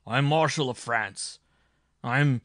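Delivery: loud, proud bellow of rank; thundering. A narrator's reading. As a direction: roar out your title in outrage. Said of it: "thundered the marshal".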